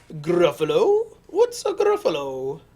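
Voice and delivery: loud owl voice; baffled owl. Acted. Owl asks mouse about the Gruffalo, loud and baffled. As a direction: boom the question as the owl.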